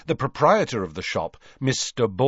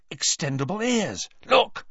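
high, eager announcement; keen, rising to a high exclamation; excited; 9 semitones higher than usual. A narrator's reading. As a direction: show it off excitedly. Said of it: "said Ron excitedly".

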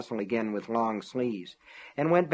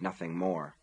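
ordinary delivery

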